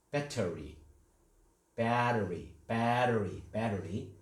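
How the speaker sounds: battery with a flapped middle, said as "badery"; American pronunciation. Shown as a demonstration. Teacher modelling battery for the class, flapped t. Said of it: "이거는 뭐 발".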